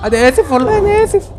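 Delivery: high squeaky pleading voice; cartoonish pleading sorry; squeaky apology. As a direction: beg forgiveness in a tiny high voice. Apologizing to character, high pitched.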